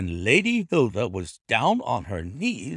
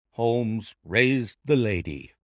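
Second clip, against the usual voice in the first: ordinary delivery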